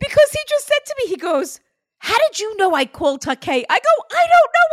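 high, loud excited retelling. Retold or imitated. Medium telling client, excited and high. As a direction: gush it out fast and high.

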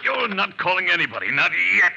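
loud, forceful warning; shouting. Acted. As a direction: stop him with a shout. Struggle over the phone.

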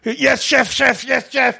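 high, hammed-up yelled yes chef; mock the frantic line cooks shouting back; imitating kitchen staff. Imitating The Bear cooks shouting at the chef.

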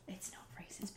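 whispered rebuttal; hushed whisper. Pretend. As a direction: whisper it back in protest. Hushed answer to mock outrage.

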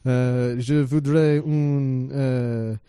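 put-on slow, halting schoolboy French accent. Tourist ordering water in France, imitated French accent. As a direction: put on a careful French accent, feeling for words. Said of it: "speaking in my French accent".

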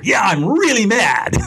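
a slow, loud, high shout of admitted anger; mock angry client. Role-playing the client finally admitting he's mad, loud shouted anger. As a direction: blurt it out like the client finally owning the rage.

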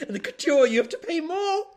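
high, loud, indignant rant. Real, not acted. Mocking the price tiers to her co-host, high and indignant. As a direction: rise in outrage at the price.

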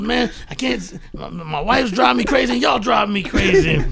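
high, loud exasperated rant; fed-up complaint. Retold or imitated. Branford venting to the roommates, high-pitched fed-up rant. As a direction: vent about the wife and roommates. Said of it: "He goes".

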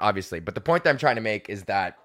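normal talking voice

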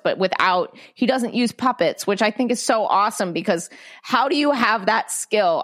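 ordinary delivery

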